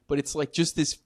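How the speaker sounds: ordinary talk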